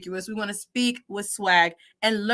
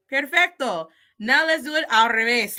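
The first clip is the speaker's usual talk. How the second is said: normal talking voice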